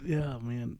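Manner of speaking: normal talking voice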